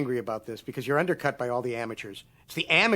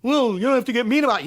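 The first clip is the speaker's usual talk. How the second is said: higher, prissy mock voice; mock whiny studio rep; 8 semitones higher, 4 dB louder than usual. Studio rep complaining he's mean. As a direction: whine it back. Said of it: "How about that?".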